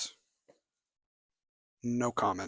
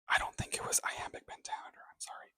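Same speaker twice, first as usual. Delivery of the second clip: a hushed whispered aside; 11 dB quieter, faster than usual. Real, not acted. Whispering a doubt about the translation's meter to the viewers. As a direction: drop to a secret whisper. Confessing a doubt under her breath.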